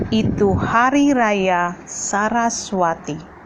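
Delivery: put-on declaimed children's poem, line by line; poem recitation. Shown as a demonstration. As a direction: recite it as a model poem for schoolchildren. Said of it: "membaca puisi Hari Raya Saraswati dengan lafal, intonasi".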